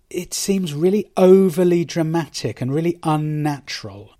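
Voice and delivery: ordinary delivery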